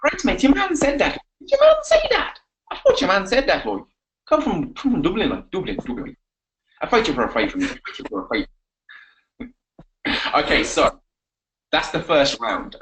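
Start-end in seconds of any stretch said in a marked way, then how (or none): 0.0-8.5 banter in put-on Dublin Irish; Irish accent. Shown as a demonstration. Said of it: "My turn, okay?".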